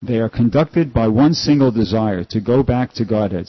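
loud, formal reading voice; scripture reading. Acted. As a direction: read the passage aloud with weight. Reads scripture aloud.

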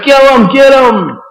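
slow, high, loud yelling of a hostile crowd; mock mob shout. Crowd chasing Nitai and Haridas down the street, shouting. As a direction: bellow it like an angry mob chasing them.

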